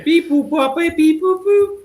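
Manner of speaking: high robotic beep-boop noises. Pretend. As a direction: chirp like a phone typing. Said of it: "texting sound effects".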